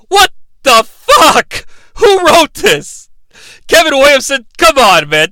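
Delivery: high, loud, stretched-out disbelief; exasperated rant. Real, not acted. Host demands who wrote this, exasperated. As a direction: holler in comic outrage at the writer. Ranting at the show's writer.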